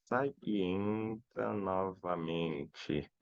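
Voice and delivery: very slow, drawn out and soft; low, quiet, dragging word by word; talking while writing. Real, not acted. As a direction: stretch each syllable as you write it down. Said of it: "Eu vou escrever aqui".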